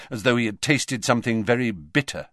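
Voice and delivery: usual speaking voice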